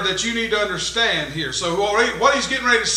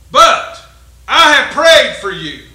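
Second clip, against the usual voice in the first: loud, slow, higher proclamation; preaching climax; 3 semitones higher, 8 dB louder, slower than usual. Acted. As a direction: build it up from the pulpit. Preacher building up Jesus' words.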